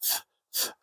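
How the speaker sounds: "th" as a light voiceless puff. Shown as a demonstration. Teacher explaining the phonetic symbol, lighter "th". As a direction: let air slip over the tongue for "th". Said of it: "lighter th".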